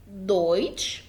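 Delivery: slow دويتش starting with a clear D; low-pitched دويتش as "DOYTCH"; initial D sound. Shown as a demonstration. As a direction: model دويتش with a voiced D. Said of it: "بينطق دي".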